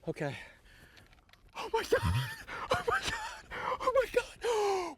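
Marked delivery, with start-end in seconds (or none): 1.6-5.0 piercing high shrieks of joy; shrieking jackpot winner. Real, not acted. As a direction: shriek like you just won the lottery. Five pound fish landed.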